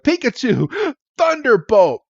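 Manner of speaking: high-pitched attack command; mock battle cry. Ash ordering Pikachu to attack, mock battle cry. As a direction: call out the move like a cartoon trainer.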